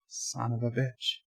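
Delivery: very soft, low delivery; hushed variant. Shown as a demonstration. Streamer demonstrating ways to say it, hushed and low. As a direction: keep it low and barely voiced. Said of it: "how many different ways can you say".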